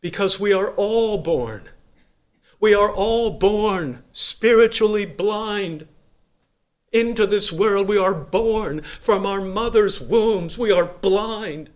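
loud, high, slow preacher's cry; booming revival preaching; full-force preaching. Acted. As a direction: thunder it from the pulpit.

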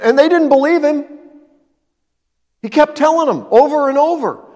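ordinary talk

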